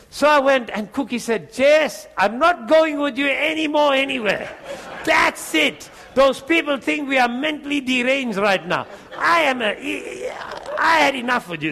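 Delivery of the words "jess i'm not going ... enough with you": high, raised mock outburst; fed-up wife. Wife refuses to go anywhere with husband, exasperated. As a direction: imitate a wife who has had enough. Wife's acted outburst in retold quarrel.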